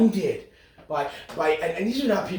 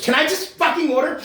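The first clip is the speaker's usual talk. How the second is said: much higher, louder exasperated snap; mock the entitled caller losing it; hammed-up rude customer outburst; snapping customer; 11 semitones higher, 6 dB louder than usual.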